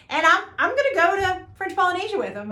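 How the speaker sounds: high, loud, fast rush of news; woman phoning her husband, giddy and fast; excited phone call. Retold or imitated. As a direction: tell your husband breathlessly. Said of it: "She's like".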